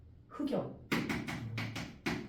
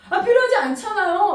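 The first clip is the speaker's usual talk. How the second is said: high, loud whiny husband; mock his polite whine, higher and louder; husband politely protesting, voiced high and loud; imitating husband; 10 semitones higher, 9 dB louder than usual. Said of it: "우리 남편 ... 존댓말 하거든요".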